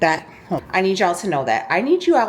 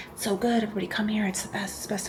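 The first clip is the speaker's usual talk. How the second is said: softer, mocking influencer hype; 2 semitones higher, 9 dB quieter, faster than usual. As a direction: mimic a gushing TikTok influencer, sarcastically. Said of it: "Everybody's all over TikTok".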